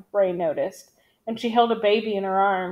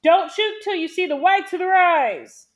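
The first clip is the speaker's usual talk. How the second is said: high, raised commanding yell; yelled orders; 7 semitones higher, 4 dB louder than usual. A narrator's reading. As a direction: shout firm orders to the squad. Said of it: "Big John yelled".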